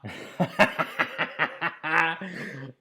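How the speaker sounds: long, loud burst of laughter; real laughter. Real, not acted. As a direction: crack up at your own joke. Laughs at accent bit.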